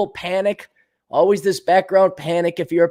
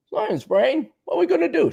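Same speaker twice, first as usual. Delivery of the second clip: a higher, silly cartoon sidekick voice; Pinky voice; 11 semitones higher than usual. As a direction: play the dim sidekick asking his leader. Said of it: "Same thing we do every night, Pinky".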